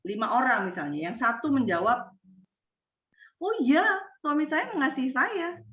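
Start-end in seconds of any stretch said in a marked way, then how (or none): 3.4-5.6 bright, high, eager yes; proud, cheerful wife, higher pitch; happy wife. Shown as a demonstration. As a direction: beam as you say it. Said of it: "Perhatikan ekspresi saya".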